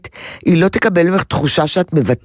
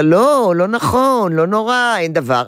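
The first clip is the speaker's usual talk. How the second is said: higher-pitched mock reassurance; ביטול מרגיע; 6 semitones higher than usual. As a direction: brush the fear away in a raised voice. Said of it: "אני לא מבטלת ואומרת לה". (ביטול הפחד בטון גבוה)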